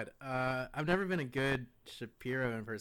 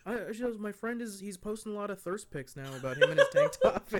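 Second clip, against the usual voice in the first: higher-pitched mock friend voice; acted friend quote; 8 semitones higher, 8 dB louder than usual. Friend griping about her thirst pics, higher mock voice. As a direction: lightly imitate a guy fretting about his friend. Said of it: "he was just like".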